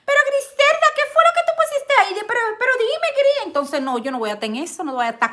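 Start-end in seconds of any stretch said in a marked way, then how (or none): 0.0-3.3 high, fast mock nagging voice; imitating late viewers. Imitating latecomers asking what she posted, high nagging voice. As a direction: ask in a squeaky, fast nag. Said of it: "la que lleguen van a decir".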